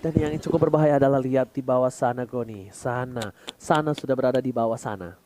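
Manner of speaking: very low, quiet, rumbling voice; deep voice. Real, not acted. Caster drops into a deep low voice.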